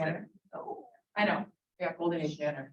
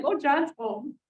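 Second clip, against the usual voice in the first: wry remark; 8 semitones higher, slower than usual. Retold or imitated. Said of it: "I'm like".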